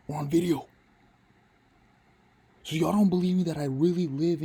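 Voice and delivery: startled and loud, pitch jumping up; Yo! shouted out in real alarm. Real, not acted. Hearing gunshots mid-lesson, loud startled outburst.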